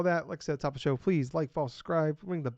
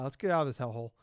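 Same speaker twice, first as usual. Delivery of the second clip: normal talking voice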